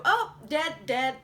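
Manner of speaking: ordinary talk, nothing marked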